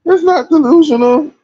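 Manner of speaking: high squeaky insistence; squealed protest. Real, not acted. Repeats phrase, pitch falls each time.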